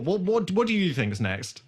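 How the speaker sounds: ordinary talk, nothing marked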